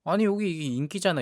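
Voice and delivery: ordinary talk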